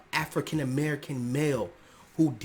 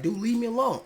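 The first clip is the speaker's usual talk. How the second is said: much higher, loud annoyed friend; high mock brush-off; 10 semitones higher, 6 dB louder than usual. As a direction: squeal it like a fed-up buddy. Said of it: "If they like".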